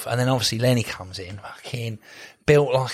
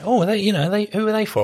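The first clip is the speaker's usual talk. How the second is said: higher-pitched mock enquiry; imitated nosy stranger; 7 semitones higher than usual. Shopper asking about his purchase, higher imitated voice. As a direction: ask in a higher put-on voice. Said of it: "someone went".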